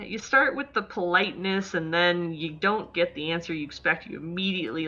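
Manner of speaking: ordinary talk, nothing marked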